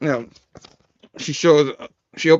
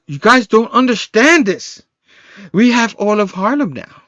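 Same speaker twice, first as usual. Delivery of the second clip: raised, louder acted insistence; mock-heated reply, pitched up; emphatic retort; 8 semitones higher, 4 dB louder than usual. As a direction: push back loudly, higher than usual talk. Acted argument back at the gang.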